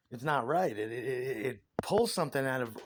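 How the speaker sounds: ordinary talk, nothing marked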